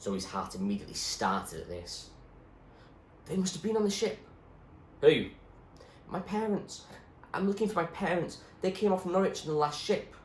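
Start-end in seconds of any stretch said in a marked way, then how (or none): 3.3-10.2 put-on higher, eager girl's voice; young girl Zoe. Zoe asking the doctor about her parents, higher anxious voice.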